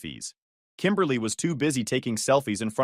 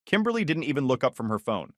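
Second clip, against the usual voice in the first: plain reading voice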